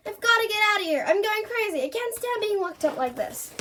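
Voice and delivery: high-pitched frantic complaint; panicked. A narrator's reading. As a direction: cry out that you can't take it, voice lifted high. Panicked complaint in a read story.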